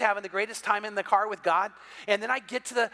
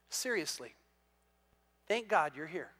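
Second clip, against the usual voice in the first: mean every word this time; sincere; 4 semitones lower, 8 dB quieter than usual. Retold or imitated. Golfer quietly and sincerely thanks the pastor for being there. Golfer stops laughing, turns serious.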